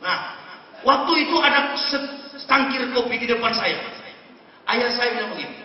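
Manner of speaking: usual speaking voice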